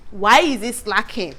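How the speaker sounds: ordinary talk, nothing marked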